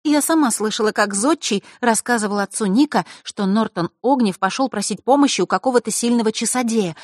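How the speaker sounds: put-on high, animated girl's voice; Diana, eager informant. A narrator's reading. Diana tells friends what she overheard, eager high voice. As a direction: pass on overheard news in a high voice.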